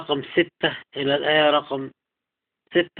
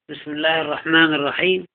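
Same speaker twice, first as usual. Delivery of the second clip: normal talking voice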